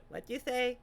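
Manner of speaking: high, soft mimicry; soft impression lifted up high; imitating his voice. Viewer showing how he sounded, high soft imitation. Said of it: "That's how he sounded".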